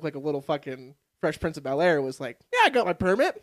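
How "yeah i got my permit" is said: cocky claim. Retold or imitated. Cocky teen lying about permit.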